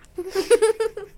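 high burst of giggling laughter; bright high-pitched giggle; laughing. Acted. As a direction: laugh out loud as the friend. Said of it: "laughed Altana".

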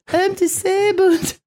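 mock whiny voice. Quoting the man mockingly.

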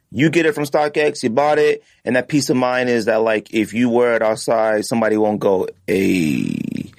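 ordinary talk, nothing marked

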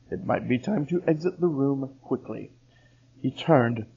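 ordinary delivery

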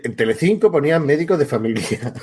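normal talking voice

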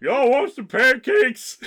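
high, loud, drawn-out granny holler; slow hammed-up grandma caricature; Fat Grandma. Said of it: "me as Fat Grandma talking like".

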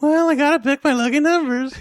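high put-on wife voice; imitated wife. Wife answering her husband about lotto picks, mock high voice. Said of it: "My wife uses the same numbers".

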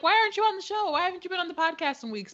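high, fast mock questioning; mock fans asking. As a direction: imitate eager fans. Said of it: "People be like".

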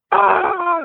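sharp high scream of terror; waking scream. Retold or imitated. Waking in the tent from a bear nightmare, high terrified scream. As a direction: burst awake screaming at the bear. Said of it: "I start, like, screaming".

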